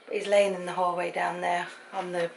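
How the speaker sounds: ordinary talk, nothing marked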